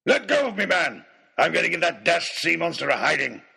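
high, loud blustering aristocrat; indignant bellow. A narrator's reading. Baronet wrestled back from the ship's side, indignant bellow. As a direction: bluster and roar in outrage.

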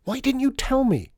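very high, loud alarmed cry; urgent outburst. A narrator's reading. Lydia springing up on hearing of the queue, urgent outburst. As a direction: burst out in excited panic.

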